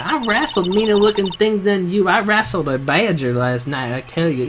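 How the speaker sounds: boastful Southern bluster; a feisty Southern woman bragging; Southern lady accent. Acted. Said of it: "this lady's voice".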